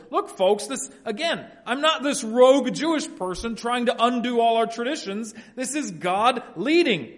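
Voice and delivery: high, emphatic pleading, slowing on key points; impassioned protest. Acted. Peter defending God's leading to folks, impassioned.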